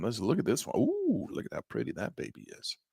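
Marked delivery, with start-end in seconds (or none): 0.8-1.3 high squeal of delight; a sudden high, thrilled ooh; admiring chart ooh. Real, not acted. 1.3-2.8 low, hushed, whispered praise; whispered admiration at a chart; admiring whisper. Real, not acted.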